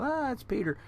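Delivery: high, sighing mock scoff; mock dismissive. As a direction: brush Peter off with a high, mocking scoff. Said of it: "and we go".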